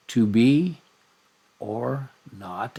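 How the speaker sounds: "to" light, "be" and "not" hit; iambic stress. Shown as a demonstration. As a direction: tap out da-DUM da-DUM. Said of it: "B is accented".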